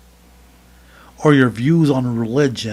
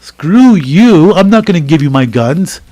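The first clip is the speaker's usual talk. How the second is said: defiant; 2 semitones higher, 7 dB louder, slower than usual. Pretend. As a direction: spit it back at the invader. Defiant reply to invader.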